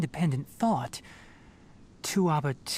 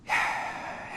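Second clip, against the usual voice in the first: soft sigh; 4 dB quieter than usual. Real, not acted. Sad conclusion about Karkata.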